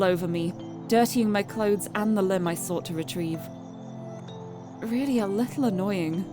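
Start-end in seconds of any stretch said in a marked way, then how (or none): none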